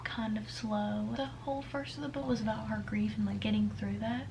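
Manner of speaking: soft hushed whisper. Real, not acted. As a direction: keep it to a quiet whisper. Whispering while reading.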